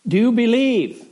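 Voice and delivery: lightly voiced heavenly questioner. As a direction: speak as the voice from the heavens. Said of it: "a voice answered from the heavens".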